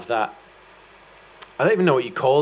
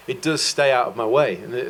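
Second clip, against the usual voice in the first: ordinary talk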